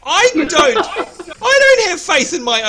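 high, loud words broken by laughter; giggling confession of no skill; laughing. Real, not acted. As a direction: laugh through the self-mockery, slowly. Laughing at himself.

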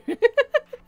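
sudden high-pitched laugh. Real, not acted. Laughs at chat's suggestion.

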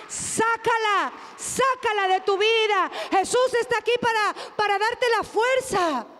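rally the congregation, hammering each phrase; preaching at full force. Acted. Pastor urging the church to cast out sadness, preached loud and high.

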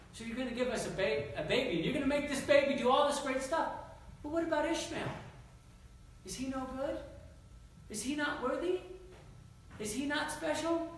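pleading father. Acted. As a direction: beg for your son.

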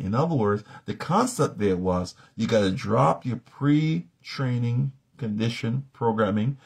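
normal talking voice

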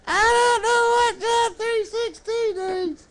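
put-on high, slow puppet voice as a little boy. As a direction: draw it out in a high puppet pitch.

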